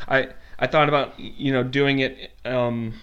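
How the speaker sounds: ordinary talk, nothing marked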